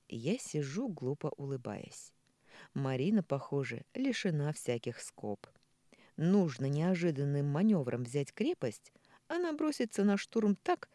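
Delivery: usual speaking voice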